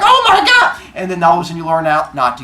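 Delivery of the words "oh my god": high, loud mock horror; horrified outcry. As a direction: shriek it like a shocked child. Horrified listener in a story.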